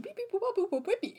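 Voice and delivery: high mock computer beeps; robot beeping. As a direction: beep like a little machine. Machine noises for entering wish.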